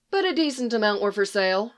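ordinary delivery

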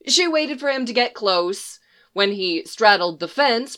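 plain reading voice

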